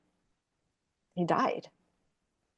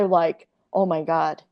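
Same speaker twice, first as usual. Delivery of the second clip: ordinary talk, nothing marked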